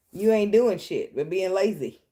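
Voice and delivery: mock-stern lecture to her cat; scolding the cat. Real, not acted. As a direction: tell off the lazy cat.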